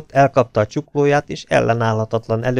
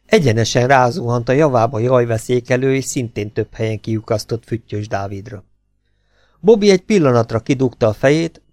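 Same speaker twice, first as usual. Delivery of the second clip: ordinary delivery, nothing marked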